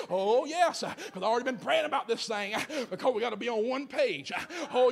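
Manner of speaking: preacher building to a roar about unity; preaching at full force. Real, not acted. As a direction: whip the congregation up until shouting.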